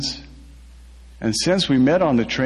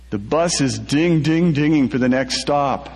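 plain reading voice

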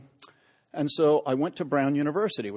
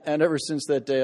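ordinary talk, nothing marked

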